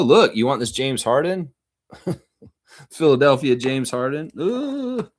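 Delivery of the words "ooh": a high, impressed ooh; admiring ooh. Real, not acted. Showing a card on camera, a high impressed ooh. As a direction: let out a high ooh of delight.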